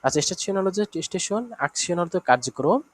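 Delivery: ordinary talk, nothing marked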